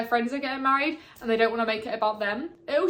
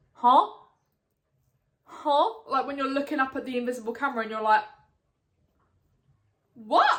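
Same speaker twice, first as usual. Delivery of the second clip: stare at the camera baffled; mock confusion. Showing how the book leaves her, mock bewildered. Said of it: "leaves me like".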